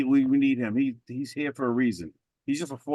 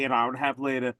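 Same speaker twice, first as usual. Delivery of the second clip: normal talking voice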